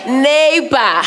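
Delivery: with attitude. Shown as a demonstration. Moderator rallying the audience to greet their neighbor, with attitude. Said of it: "you have to say it with attitude".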